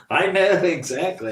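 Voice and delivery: laughing through the words loudly. Real, not acted. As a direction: chuckle while admitting it. Laughing at his own mistake.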